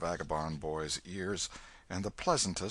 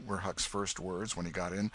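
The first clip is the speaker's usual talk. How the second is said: plain reading voice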